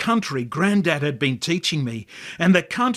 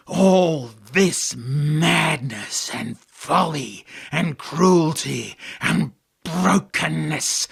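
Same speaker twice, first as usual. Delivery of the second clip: slow, weary and cruel, each word dragged out; ancient contemptuous angel; slower than usual. Acted. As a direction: tempt him slowly, full of contempt. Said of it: "ancient and weary and cruel and contemptuous".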